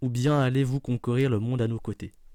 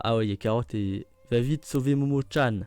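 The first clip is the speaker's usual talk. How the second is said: ordinary talk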